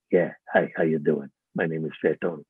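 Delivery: low mobster voice modelled on an uncle; deep thug greeting; Fat Tony voice. Retold or imitated. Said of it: "I channeled my Uncle Willie".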